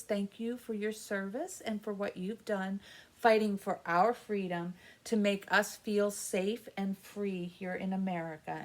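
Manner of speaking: normal talking voice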